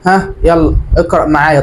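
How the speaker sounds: ordinary talk, nothing marked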